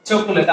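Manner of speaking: চকোলেট with a full middle o; common mispronunciation. Shown as a demonstration. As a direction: say চকোলেট as cho-ko-let. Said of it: "আমরা অনেকেই বলি এটাকে". (ভুল উচ্চারণে চকোলেট, "চ-কো-লেট")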